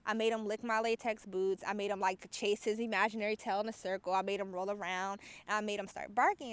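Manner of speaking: usual speaking voice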